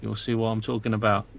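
a spooked dwarf whispering-low to his friend; low hushed dwarf Leopold. Acted. Player voicing Leopold character.

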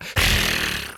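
dry hissing rattle sound; rattlesnake hiss. Retold or imitated. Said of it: "that's my rattlesnake voice".

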